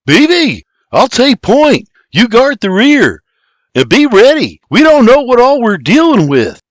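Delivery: high, loud commanding call; urgent orders. A narrator's reading. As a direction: call out orders to your companion. William gives orders on setting out.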